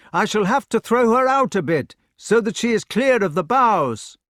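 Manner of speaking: high, loud call to someone far below; shouting down. A narrator's reading. Knight up a tree to the lady below, shouting. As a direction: shout it down from a height. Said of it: "He shouted to the lady".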